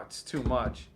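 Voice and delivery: usual speaking voice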